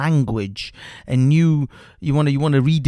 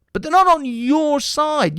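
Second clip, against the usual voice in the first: angry outburst; 11 semitones higher than usual. Real, not acted. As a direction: slam the denial home. Angry rant at opponents.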